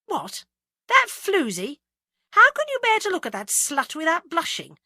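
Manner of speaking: high, loud outrage; indignant demand. A narrator's reading. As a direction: demand an answer, scandalised. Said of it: "demanded Agatha".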